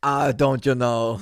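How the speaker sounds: slow Midwest drawl; Wisconsin accent. Pretend. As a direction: say it like a Wisconsin local. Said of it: "sound like he's from Wisconsin".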